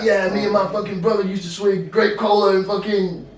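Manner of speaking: loud, high rapper impression; mock rapper recalling. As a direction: ham up the rapper's excited story. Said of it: "And he's like".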